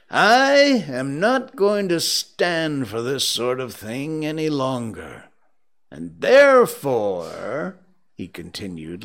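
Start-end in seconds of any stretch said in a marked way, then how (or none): 0.1-5.3 slow, high, loud scolding; mock-stern rebuke. Scrooge scolds his late clerk, feigning anger. 5.9-7.8 loud, slow, ending on a rise; mock-stern build-up. Scrooge threatens his clerk, mock-stern and suspenseful. First take, menacing and interrupted.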